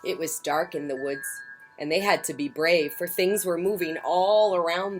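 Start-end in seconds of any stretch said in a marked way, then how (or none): none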